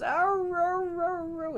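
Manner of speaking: very high yowling howl; high dog ow-row howl. Acted. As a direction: howl like a sad shaved puppy. Dog howls while shaved.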